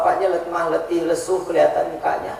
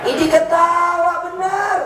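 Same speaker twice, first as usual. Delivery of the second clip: laughing; 9 semitones higher, 4 dB louder than usual. Real, not acted. Audience laughing hard.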